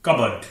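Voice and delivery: कबर्ड as CUB-urd, falling; correct pronunciation. Shown as a demonstration. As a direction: drop the p in कबर्ड. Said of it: "इसे बोलेंगे कबर्ड". (सही उच्चारण, प silent कबर्ड)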